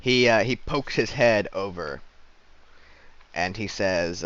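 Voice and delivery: usual speaking voice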